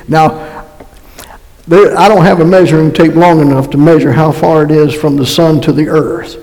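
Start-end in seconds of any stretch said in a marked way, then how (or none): none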